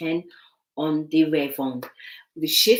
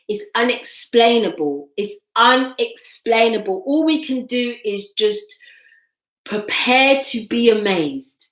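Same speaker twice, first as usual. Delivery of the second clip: emphatic proclaiming; 5 semitones higher, 6 dB louder than usual. Real, not acted. Emphatic prophecy to viewers.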